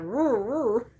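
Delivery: mock little dog roo roo; playful dog woof imitation; imitating dog bark. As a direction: copy the dog's bark. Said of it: "she does that".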